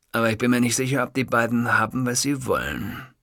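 usual speaking voice

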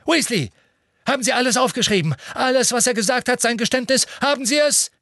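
high, loud, fast gloating questions; trembling with glee. A narrator's reading. Minister eagerly demands the clerk's notes, fast and loud. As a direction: rush the questions in triumph. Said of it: "zitterte nun sichtlich vor Freude".

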